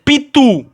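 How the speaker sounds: "Pitu" loud and high, weight on TU; stress on TU. Shown as a demonstration. Teacher showing students where the accent falls, stress on TU in "Pitu". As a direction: hit the final TU of "Pitu". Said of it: "o acento é tu".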